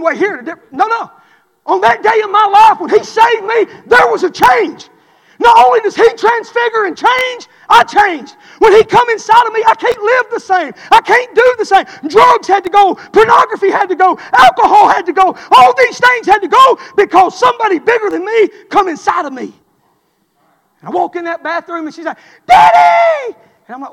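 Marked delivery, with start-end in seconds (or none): 1.6-19.5 loud, high, forceful testimony; shouted sermon cadence; full-force preaching. Real, not acted. 22.5-23.4 high, loud drawn-out yell imitating daughter; mimicked child's shriek for help; daughter's holler. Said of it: "she hollers".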